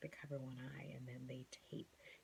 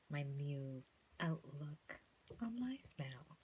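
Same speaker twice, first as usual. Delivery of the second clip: punny emphasis; much slower than usual. Real, not acted. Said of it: "See what I did there?".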